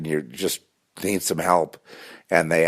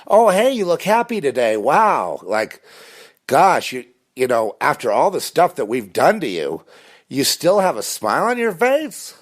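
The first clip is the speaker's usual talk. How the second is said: high, loud, mock-amazed stranger voice; 10 semitones higher, 5 dB louder than usual. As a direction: play a fake-friendly passerby marvelling at him. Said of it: "A random stranger".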